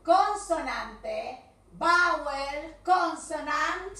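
loud, slow drilled rule; loud teacher chant, every word stretched; voice-straining emphasis. Shown as a demonstration. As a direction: hammer each term out slowly. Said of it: "porque casi me quedo sin voz".

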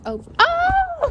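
high startled yelp into a giggle; yelp, then laugh it off; sudden yelp. Real, not acted. Vlogger breaking off her sentence, startled yelp.

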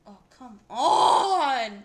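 loud, drawn-out slow groan of frustration; a real frustrated wail; frustrated yell. Real, not acted. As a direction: let the whole room hear you lost. Game loss, frustrated outburst.

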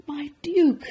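high, loud, imploring appeal; pleading. Acted. Baker begging the Duke, high plea. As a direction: appeal to him desperately. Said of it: "pleaded Walter".

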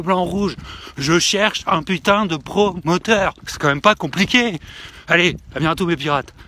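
loud hammed-up pirate captain yelling; pirate shout. Captain bellows greetings to his pirate crew.

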